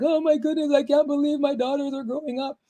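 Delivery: high, far-off voice of a mom gushing over her growing daughters; emotional mom. Retold or imitated. Mom going through scrapbooks about her grown daughters, high overcome voice. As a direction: play it like a mom welling up over old photos. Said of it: "being like".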